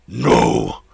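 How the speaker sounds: loud, sharp cry of refusal. Acted. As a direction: bark out the refusal. Werewolf refuses master.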